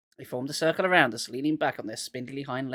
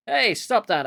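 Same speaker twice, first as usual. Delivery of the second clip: raised, higher shout; 6 semitones higher, 6 dB louder, slower than usual. A narrator's reading. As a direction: shout at them to stop. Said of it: "I heard Marty shout".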